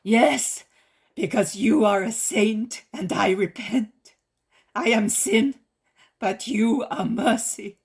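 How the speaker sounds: loud, impassioned begging; fervent plea. A narrator's reading. Leper begging Jesus to cleanse her, loud and fervent. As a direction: plead with all your soul.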